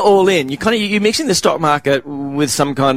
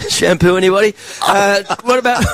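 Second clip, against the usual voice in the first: mock salesman call; 7 semitones higher than usual. Mock sales pitch shout.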